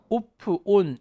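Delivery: "off" and "on" Koreanized as "o-pu", "oon"; mock-awkward closed-O "off", "on"; wrong vowels. Shown as a demonstration. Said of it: "그러니까 힘들지". ("off", "on"을 '오프', '온'으로 틀리게 발음)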